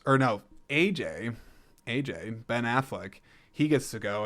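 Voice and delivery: ordinary talk, nothing marked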